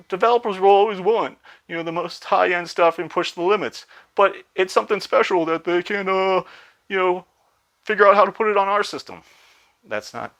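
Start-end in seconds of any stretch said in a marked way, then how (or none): 0.1-9.2 high-pitched, half-joking put-on Japanese accent; mock Japanese accent. Mimicking Nintendo's shareholder-meeting answer in a high mock Japanese accent. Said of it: "Japanese accent".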